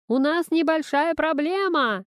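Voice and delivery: high, drawn call across rooms; yelling. A narrator's reading. Father reports trouble from the kitchen, yelling. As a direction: pitch it up to carry through the flat. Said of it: "заорал Игорь из кухни".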